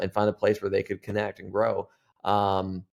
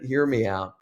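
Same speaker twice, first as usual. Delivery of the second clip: normal talking voice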